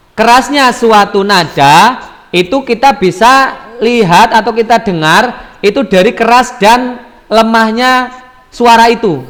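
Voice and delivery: ordinary talk, nothing marked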